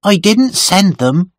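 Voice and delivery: high-pitched defensive confession; defiant servant. A narrator's reading. Servant admitting she kept the letters, defiant. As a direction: own up without apology.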